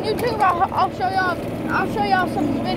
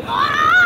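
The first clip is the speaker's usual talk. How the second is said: startled yelp; 9 semitones higher, 7 dB louder than usual. Retold or imitated. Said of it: "You're like".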